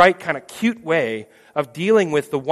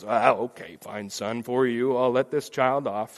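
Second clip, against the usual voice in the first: lower, softer reluctant give-in; grudging father; 5 semitones lower, 6 dB quieter than usual. Pretend. The Father gives in to his son, low and grudging. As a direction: sigh it out, giving in unwillingly.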